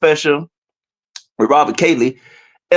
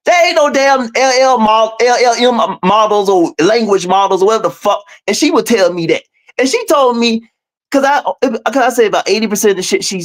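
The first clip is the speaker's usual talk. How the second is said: usual speaking voice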